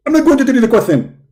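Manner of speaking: high, loud, indignant preacher voice; Zakir Naik impression. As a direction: bark it like a self-righteous debater. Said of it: "My name is Zakir Naik".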